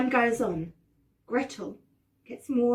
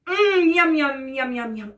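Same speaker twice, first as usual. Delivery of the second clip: greedy eating; 4 semitones higher, 6 dB louder than usual. Acted. Acting licking chocolate off finger.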